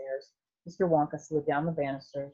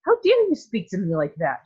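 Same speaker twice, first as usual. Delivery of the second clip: mild mock outrage, louder; indignant shout; 4 semitones higher, 6 dB louder than usual. A narrator's reading. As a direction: huff it out, offended. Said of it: "shouted Missus Salt".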